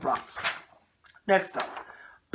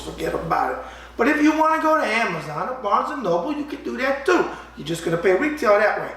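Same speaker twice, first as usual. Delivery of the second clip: ad read; 3 semitones higher, 5 dB louder than usual. Acted. Host plugging his boxing book to viewers, hammy sales pitch. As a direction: hawk the book like a New York huckster.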